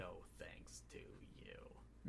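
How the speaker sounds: hushed, slow and cutting; slow whispered put-down; cold whisper. Retold or imitated. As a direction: breathe it out like a quiet reproach. Tony scolding Peter, acted quote.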